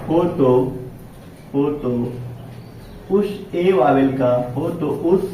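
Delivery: word-by-word dictation with long pauses. Real, not acted. Teacher writing out the rule for students, dictation. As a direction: dictate the rule slowly, repeating each piece.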